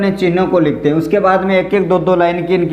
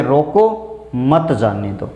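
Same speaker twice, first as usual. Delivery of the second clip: rising रोको, short stop, lower मत जाने दो; pause after रोको; 5 semitones lower, slower than usual. Shown as a demonstration. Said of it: "इस तरीके से". (रोको के बाद ठहराव)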